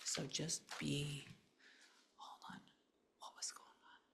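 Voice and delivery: hushed, breathy whisper to a spirit; a quiet medium's murmur; whispered séance. Acted. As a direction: whisper as if sensing someone near.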